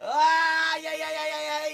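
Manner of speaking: high, loud cry of joy; excited shout. Real, not acted. Host reacting to a huge pull, loud high shout. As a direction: scream like you just hit the jackpot. Big hit pulled.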